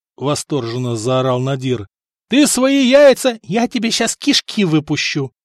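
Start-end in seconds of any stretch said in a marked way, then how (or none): 2.3-5.3 high, threatening yell; angry shout. A narrator's reading. Stefan fed up with local wit, angry shout. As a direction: shout the threat, pitched well up. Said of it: "крикнул Стефан".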